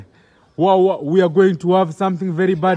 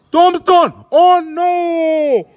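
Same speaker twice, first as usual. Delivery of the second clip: much higher and louder shout of the move; excited call; 8 semitones higher, 4 dB louder, much slower than usual. Real, not acted. Commentator yelling the Tombstone to viewers, high and loud. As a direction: yell it as the big move lands.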